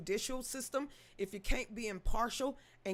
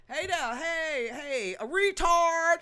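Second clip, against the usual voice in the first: slow, higher dragged-out read; reading the comment slow and higher; mock drawn-out greeting; 5 semitones higher, 7 dB louder, much slower than usual. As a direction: stretch it out like a goofy caller.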